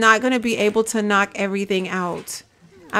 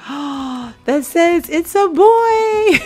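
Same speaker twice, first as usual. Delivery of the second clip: gasping delight; 8 semitones higher, 5 dB louder, much slower than usual. Real, not acted. Showing viewers the hidden balloon, gasping and laughing. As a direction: let the reveal burst out in a laugh.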